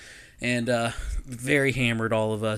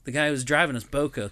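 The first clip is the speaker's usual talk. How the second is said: ordinary delivery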